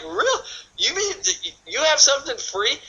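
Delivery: very high, incredulous squeak; amazed prospect. Acted. Prospect hears of something free, high-pitched amazement. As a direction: act out high-pitched disbelief.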